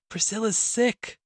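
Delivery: high, slow, wailing moan; distressed moan. A narrator's reading. Woman tells neighbour her sister is ill, moaning. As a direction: moan it out in anguish. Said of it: "moaned the distressed voice".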